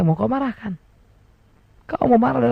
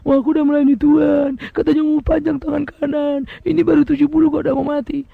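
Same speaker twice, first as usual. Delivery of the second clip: aggrieved believer; 2 semitones higher than usual. Retold or imitated. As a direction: grumble that seventy is too young to die. Complaining believer quoted in sermon.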